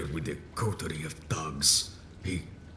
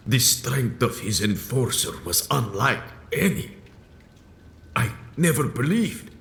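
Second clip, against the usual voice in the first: anguished outcry; 8 semitones higher, 5 dB louder than usual. Acted. Elder recalls enforcer's power.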